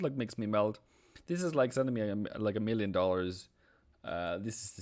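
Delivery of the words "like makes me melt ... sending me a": ordinary delivery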